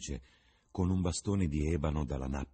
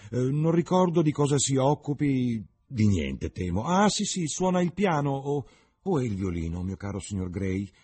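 higher, louder gushing hostess voice, scatterbrained; mock the fluttering society lady who can't remember; society hostess impression; 10 semitones higher, 7 dB louder than usual. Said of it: "vaghezze del tipo".

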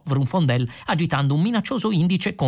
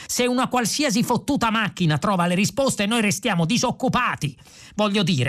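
high, heated complaining; exasperated tirade; indignant rant; 8 semitones higher than usual. A narrator's reading. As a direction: build an outraged argument in a raised pitch. Philosopher rants about losing his job.

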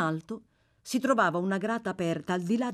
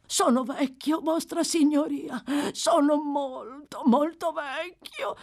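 put-on high, wailing old man's plea; pitiful high-pitched appeal; pleading old prisoner; 10 semitones higher, 3 dB louder, slower than usual. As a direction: plead with the judge, dragging the last words.

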